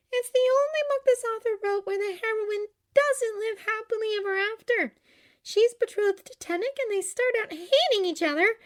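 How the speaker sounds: put-on high, loud young woman; high, heated girlish retelling; girlish Glinda, indignant. A narrator's reading. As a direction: complain about a plot in a high voice. Glinda's character voice.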